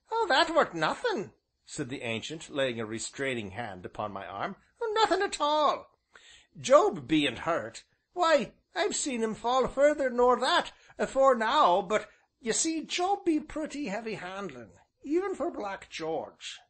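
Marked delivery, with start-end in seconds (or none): 0.1-1.3 put-on high old countryman's voice; old rustic innkeeper. A narrator's reading. Ancient's dialect voice. 4.8-16.6 put-on high old countryman's voice; old rustic innkeeper. A narrator's reading. Ancient's dialect voice.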